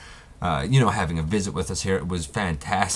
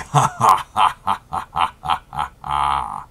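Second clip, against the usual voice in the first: villain laugh; 2 semitones lower, 4 dB louder than usual. Acted. Villain's laugh after speech.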